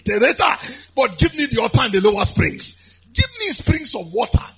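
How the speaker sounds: loud, high, urgent petition to God; impassioned preaching plea. Acted. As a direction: cry out the request at full preaching force. Preaches the plea at full force.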